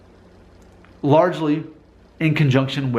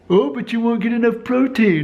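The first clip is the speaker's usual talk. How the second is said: higher mock voice of a doubter; mocking skeptic; 5 semitones higher than usual. Mocking people who warn vegans about protein, in a higher voice. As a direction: parody the protein worriers, pitched up. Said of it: "I say that because people".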